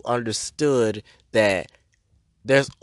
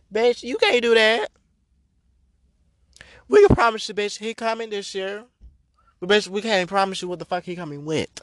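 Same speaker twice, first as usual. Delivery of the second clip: heated high rant; 8 semitones higher than usual. Real, not acted. Ranting Santa comparison, pitched way up.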